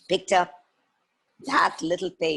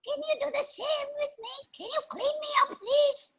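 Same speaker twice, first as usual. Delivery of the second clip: very high squeaky creature voice; pleading little fox; 3 dB quieter than usual. Acted. Second fox asking to be washed, pleading. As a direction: squeak out an eager request. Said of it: "the second fox came".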